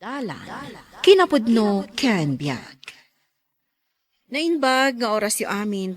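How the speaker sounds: normal talking voice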